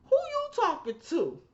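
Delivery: rude woman. Retold or imitated. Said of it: "talking about".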